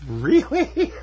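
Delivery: high-pitched, laughing incredulity; laughing disbelief. Real, not acted. Co-host groaning at a bad egg pun, laughing disbelief.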